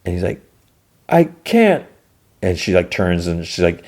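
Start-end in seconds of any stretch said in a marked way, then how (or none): 1.1-1.9 high, strained refusal; imitated anguished protest; distressed refusal. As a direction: push it out high, as if helpless. Solo refusing the plea.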